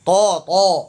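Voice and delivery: تو as a thick, full "To"; heavy Ta. Shown as a demonstration. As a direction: round out a heavy T on each تو. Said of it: "تو موٹا ہے". ("تو" موٹا ادا کریں)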